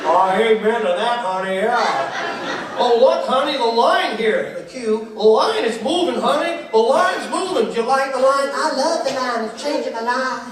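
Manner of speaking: high, loud mock gushing; excited American tourists. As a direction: marvel at everything like a thrilled tourist couple. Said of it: "I'm just so excited right here".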